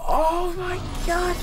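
shocked dismay. Real, not acted. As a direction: gasp in disbelief, then protest. Dramatic card reveal, gasps.

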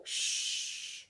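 forceful whispered shush; loud shush. Acted. Child hushing himself so parents won't wake, sharp shush. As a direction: hush yourself in a panic.

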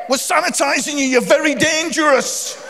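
a hint of a fussy old couple; lightly voiced scolding passenger; elderly couple. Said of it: "She go".

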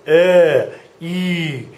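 "er" as ö loud then "E" as ü; mock Ö and Ü. Shown as a demonstration. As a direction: tease them with the two vowels.

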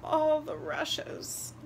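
high, breaking and tearful, fading to a whisper; tearful daughter's answer; sobbing. A narrator's reading. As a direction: answer through sobs. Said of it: "Luba answered as she sobbed".

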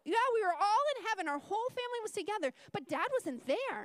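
put-on a boy rushing to explain; child voice.

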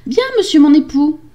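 high, dutiful wife voice; meek wife. Acted. Wife reporting to her husband, high meek voice. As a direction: answer high and obediently.

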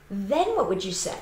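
ordinary talk

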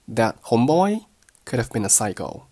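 "homeboy" rising, "psycho" stressed and falling; model sentence. Shown as a demonstration. Teacher strings the sentence together, rise on "homeboy". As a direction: lift "homeboy", keep "could have been a" weak. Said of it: "咱们适当的放慢语速".

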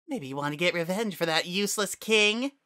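high mocking voice; taunting flower. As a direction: needle him about the king. Flowey taunts wearing Asgore's face.

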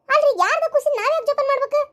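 high-pitched indignant question; indignant protest. Acted. Quarrel over keeping the child. (ಎತ್ತರದ ದನಿಯಲ್ಲಿ ಆಕ್ಷೇಪಿಸುತ್ತಾ)

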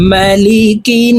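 "মালিকিন" slowly with a nasal hum on the final n; with ghunnah. Shown as a demonstration. Teacher shows the ghunnah in malikin, slow nasal hold. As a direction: hold the n through the nose. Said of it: "ওয়াজিব গুন্নাহ".

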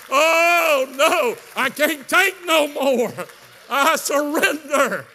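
slow, high, loud wail; wailing surrender. Retold or imitated. Preacher giving in before the cook, wailing shout of surrender. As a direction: draw each word out in a big cry of giving up. Acting his cry of surrender.

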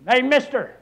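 high, loud parrot squawk; parrot call. Acted. Parrot calling to the customer at the door, high and loud. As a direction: sing out like a parrot. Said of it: "and that bird said".

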